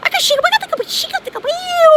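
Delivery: high, loud chanted gibberish syllables; channeled light language. Acted. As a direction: chant nonsense syllables high and fast like a possessed caller.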